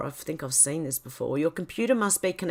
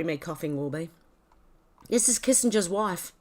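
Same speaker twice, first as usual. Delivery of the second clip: ordinary talk, nothing marked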